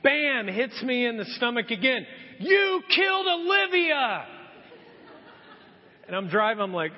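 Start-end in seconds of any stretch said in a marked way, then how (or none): 2.4-4.3 slow, high, loud accusing yell; accusing shout. Retold or imitated. Wife blaming her husband for the hamster, accusing shout. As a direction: scream the blame at him.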